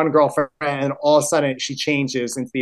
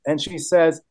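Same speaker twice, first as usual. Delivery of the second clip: usual speaking voice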